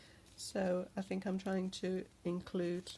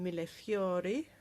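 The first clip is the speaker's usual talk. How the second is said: slow, drawn-out Italian "Mille Fiori" with the "fiori" rising; Italian accent; 5 dB louder than usual. Pretend. Showing the sister's travel charms, savouring "Mille Fiori" in an Italian accent. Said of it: "Love the Italian accent".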